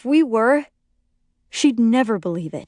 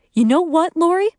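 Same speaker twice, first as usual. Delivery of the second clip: higher, pointed challenge with a rising end; cold; 8 semitones higher, 4 dB louder than usual. A narrator's reading. Friend finally turning on Lori, cold challenge. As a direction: challenge her coldly. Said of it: "I say coldly".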